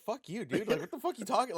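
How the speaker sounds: a replayed flare of anger at a stranger; retold angry snap. Retelling how he told off the stranger, angry snap.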